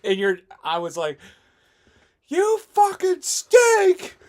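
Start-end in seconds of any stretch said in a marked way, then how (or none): 2.3-4.2 loud, high, drawn-out mock heckle; retold yelling. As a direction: scream slow insults at the rival fans, high-pitched. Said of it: "yelling the absolute".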